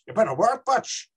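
Northern Irish accent, rising phrase ends; Belfast accent. Shown as a demonstration. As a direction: chant it in a Belfast lilt.

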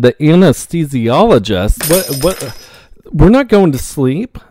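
high, rising incredulous questions; alarmed disbelief. Retold or imitated. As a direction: hear about the anesthesia for the first time and can't believe it. Shocked by news of anesthesia.

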